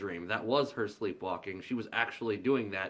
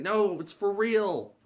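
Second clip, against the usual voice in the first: much higher, louder and slow; insistent; 11 semitones higher, 8 dB louder, slower than usual. Retold or imitated. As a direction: plead earnestly that it really happened. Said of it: "And she's like".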